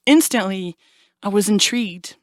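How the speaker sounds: normal talking voice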